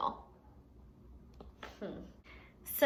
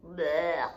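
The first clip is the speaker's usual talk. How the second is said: mock disgust; 6 semitones lower than usual. Disgust at spitballs.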